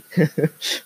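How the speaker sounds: a short laugh at his own mistake; brief embarrassed laugh; self-mocking laugh. Real, not acted. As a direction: chuckle at the slip.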